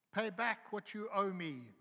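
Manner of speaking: demanding. A narrator's reading. Servant choking his fellow servant, angry demand. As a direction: bark the debt at him. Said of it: "he demanded".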